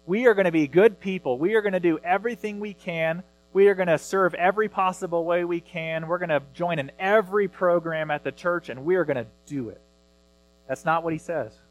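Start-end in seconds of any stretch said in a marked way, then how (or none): 0.0-9.8 higher, louder eager promise to God; mock self-reliant vow. Pastor mimicking people who vow to fix themselves, eager and raised. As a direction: sound like a keen churchgoer promising to fix it all. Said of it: "He's not saying".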